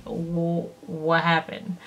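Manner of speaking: mock-nervous, hushed reply to mom; nervous quiet question. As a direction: drop to a worried hush. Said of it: "And I'm like".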